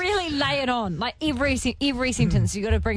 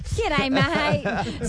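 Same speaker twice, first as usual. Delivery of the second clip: laconic outback drawl. Retold or imitated. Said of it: "You're like two old blokes in a pub".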